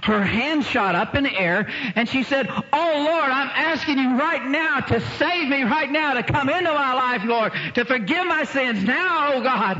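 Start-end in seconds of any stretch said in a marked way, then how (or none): 2.5-9.8 high, pleading cry to God; impassioned retold prayer, high and insistent; acted urgent prayer. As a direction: re-enact a fervent plea, pitched high. Acts out woman's conversion prayer.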